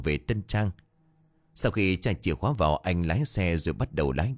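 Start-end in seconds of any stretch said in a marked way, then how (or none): none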